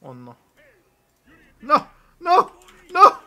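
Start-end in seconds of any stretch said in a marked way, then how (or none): 1.6-3.2 loud, high repeated screams of no; desperate yells. Real, not acted. Gamer watching a fight go wrong, loud high cries. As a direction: scream no over and over. Losing in game.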